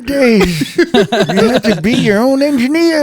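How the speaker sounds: put-on high, creaky old-timer voice laughing through it; old man voice. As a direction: play a cranky old man reminiscing. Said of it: "Give me the old man voice".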